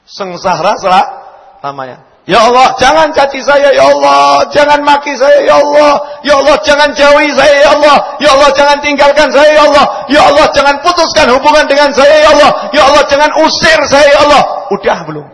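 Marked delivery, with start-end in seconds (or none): 2.3-3.7 jangan caci saya cried loud and high; fervent plea. Shown as a demonstration. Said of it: "Kapan kita zikirnya berubah?". 3.7-14.4 loud, high fervent pleading; fervent loud prayer to Allah. Acted. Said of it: "Kapan kita zikirnya berubah?".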